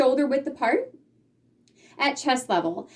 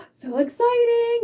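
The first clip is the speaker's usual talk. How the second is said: excited gasp; 11 semitones higher than usual. Shown as a demonstration. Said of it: "you get a big smile on your face".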